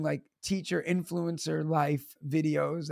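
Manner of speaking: usual speaking voice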